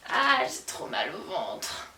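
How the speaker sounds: slow, low, soft groaning; drawn-out, suffering complaint; acted pain groan. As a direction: moan it weakly through belly cramps. Acted belly pain in skit.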